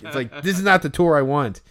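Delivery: ordinary talk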